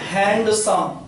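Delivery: Handsome split into hand and सम; syllable by syllable. Shown as a demonstration. Teacher showing syllable breaks, handsome measured out in two beats. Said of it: "hand एक बार में और सम एक बार में".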